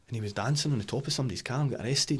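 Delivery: usual speaking voice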